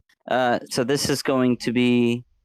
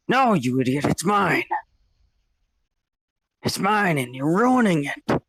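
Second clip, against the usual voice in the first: angry evil double; 5 semitones higher than usual. Acted. Reflection angrily claims body.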